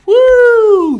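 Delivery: very high, loud halftime whoop; gleeful whoop. Retold or imitated. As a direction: let out a big victory whoop. Retelling halftime betting joy.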